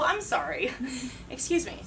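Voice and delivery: ordinary talk, nothing marked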